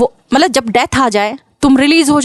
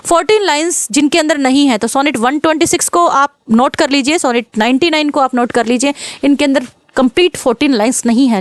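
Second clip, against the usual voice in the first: ordinary delivery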